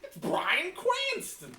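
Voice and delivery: slow, high starstruck repeat; excited. Retold or imitated. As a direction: draw it out in awe.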